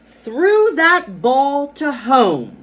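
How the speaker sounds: slow, high, loud drawn-out shout; excited stress. Shown as a demonstration. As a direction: hurl every word out with huge excitement. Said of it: "I stressed the big words".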